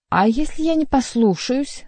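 high, challenging question; dissenting. A narrator's reading. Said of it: "придав своему голосу явственную нотку несогласия".

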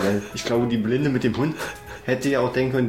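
ordinary delivery

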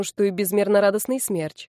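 ordinary delivery

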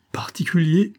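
high, rising incredulous cry; startled exclamation. A narrator's reading. Sick woman echoes Olivia's word, startled exclamation. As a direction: echo the word back, pitched right up. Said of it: "s'écria Rachel".

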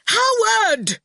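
very high, drawn-out angry shriek. A narrator's reading. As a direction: shriek the name in a temper. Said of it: "he shrieked".

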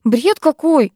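indignant, high outburst; losing patience. A narrator's reading. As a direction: burst out, unable to hold back. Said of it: "не выдержала я".

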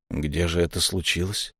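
asked softly and low, almost under breath; a hushed doctor's question; quiet question. A narrator's reading. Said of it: "тихо спросил я". (тихо, вполголоса)

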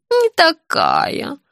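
high, very drawn-out objection; objecting old woman. A narrator's reading. Pensioner disputing the surname, stubborn old woman's voice. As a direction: contradict in a slow, high voice. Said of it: "снова возразила пенсионерка".

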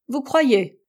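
captain mocking the American's claim, ironic. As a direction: needle him with irony. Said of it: "fit ironiquement le capitaine".

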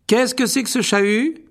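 high, loud scolding call; exclaimed. Acted. Percy arriving at the scene, high loud exclamation. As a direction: bark out over the commotion. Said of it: "s'écria la voix familière de Percy".

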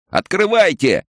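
put-on very high, piping boy's shout; pitiful, threatening child's cry; squeaky child's yell. A narrator's reading. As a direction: squeal it as a desperate little boy. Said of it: "заорал писклявым детским голоском".

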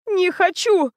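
high, sharp shout of refusal; a furious tearful no; yelling. A narrator's reading. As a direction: scream it out in tears. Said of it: "в голос заорала Лена".

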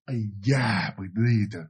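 low, soft groan of aches; pained muttering. Acted. Aunt grumbling to herself over her aching foot, low pained mutter. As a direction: mutter under your breath, nursing a sore foot.

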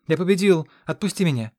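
joyful squeal. A narrator's reading. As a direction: squeal it in victory. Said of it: "взвизгнул радостный Герт".

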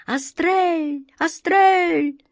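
high calling-out echoes; crowing rooster call. A narrator's reading. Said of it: "Петушиная перекличка голосов".

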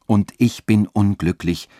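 plain reading voice

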